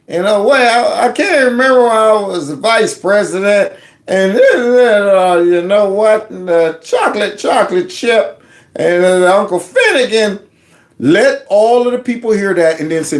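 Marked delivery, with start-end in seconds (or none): 0.5-10.4 put-on higher, rambling, forgetful old man; mock confused Joe Biden. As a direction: lose the thread mid-sentence. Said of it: "hear Joe talking about the".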